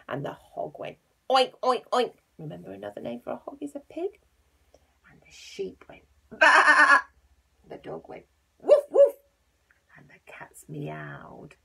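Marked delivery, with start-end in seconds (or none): 0.1-2.1 loud oinking; pig oinks. Acted. Reading the hog's oinks to children, loud oinks. 5.1-7.0 very loud slow baa; sheep baa. Acted. Reading the sheep's baa to children, loud bleat. 7.7-9.1 loud barking woof; dog woofs. Acted. Reading the dog's woof to children, loud bark. 10.0-11.5 slow soft whisper; whispered meow. A narrator's reading. Telling children the cats meowed, slow whisper.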